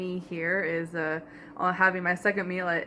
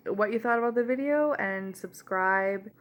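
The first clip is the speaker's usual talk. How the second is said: usual speaking voice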